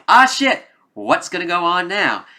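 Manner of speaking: loud, high mock-panicked outburst; hammed-up alarm. Voices viewer's reaction to the turn.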